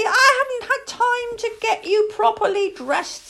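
high, pressed retort; exasperated young lady. A narrator's reading. Exasperated young lady answering back.

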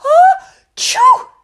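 high loud acted sneeze. Princess sneezes in front of the maid, loud high sneeze. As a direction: burst out in a big squeaky sneeze.

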